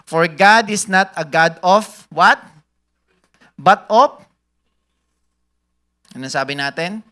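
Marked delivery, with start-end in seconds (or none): 0.1-4.2 loud, slow preacher's question; call for response. Shown as a demonstration. Pastor quizzing the church, loud and slow. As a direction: pull the answer out of the crowd. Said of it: "prompting the crowd to fill in".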